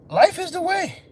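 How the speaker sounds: high, ringing, pressed hard; high emphatic. Shown as a demonstration. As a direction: proclaim it up high. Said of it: "repeated for effect".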